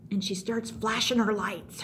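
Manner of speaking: normal talking voice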